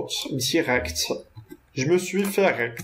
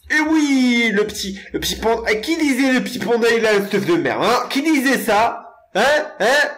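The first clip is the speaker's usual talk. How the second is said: mock taunting; 6 semitones higher, 3 dB louder than usual. Teasing viewers who mocked his stuff.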